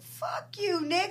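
high-pitched imitated comeback; retold angry defiance. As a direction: throw it back at him mid-argument. Retold car argument, defiant reply.

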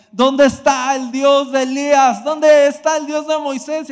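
put-on a young man yelling his complaint at God at night camp, loud and high; desperate shout to God. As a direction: shout the demand up at heaven. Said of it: "a gritarle a Dios así estas cosas".

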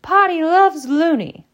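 very high, loud mocking shriek; shrieking taunt. A narrator's reading. Tormentor zooming away from his victims, shrieking taunt. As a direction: shriek the taunt with drawn-out glee. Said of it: "cackling and shrieking".

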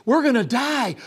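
high, loud frantic we're gonna die; high, loud panicked cry; panicked shout. Acted. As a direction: yell it in terror. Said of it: "something that's there that's like".

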